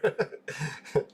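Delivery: soft airy chuckle; a quiet unvoiced laugh at the coincidence; breathy laugh. Real, not acted. Streamer chuckling to chat at the small-world story, soft airy laugh.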